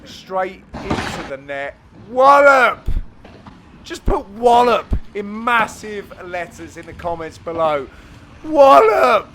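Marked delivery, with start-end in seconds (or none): 2.1-2.9 "Wallop" shouted high and loud; booming call. Real, not acted. Calling the smash over the court: loud, high "Wallop". 8.4-9.3 "Wallop" belted high and loud; shouted catchphrase. Shown as a demonstration. Showing viewers how to say it: loud, high "Wallop". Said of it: "You gotta say it like that".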